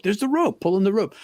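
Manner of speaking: urgent instruction. Retold or imitated. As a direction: point and urge him on. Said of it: "he's, like, showing me the rope".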